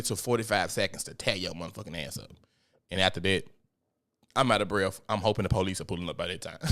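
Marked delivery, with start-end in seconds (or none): none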